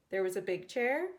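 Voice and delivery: usual speaking voice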